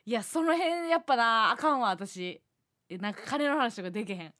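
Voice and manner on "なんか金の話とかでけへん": ordinary talk, nothing marked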